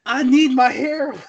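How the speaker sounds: high, laughing junkie whine; mock junkie plea. Mocking the movie's hybrid heroine craving her injection, high and laughing. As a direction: whine it like a needy addict, half-laughing. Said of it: "She needs heroin".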